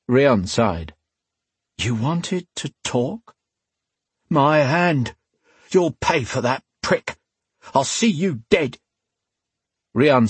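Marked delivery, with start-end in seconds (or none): 1.8-3.3 slow, soft, weary question. A narrator's reading. Rian weary. 4.3-8.8 thug voice. A narrator's reading. Wounded thug threatens.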